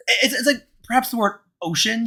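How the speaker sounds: normal talking voice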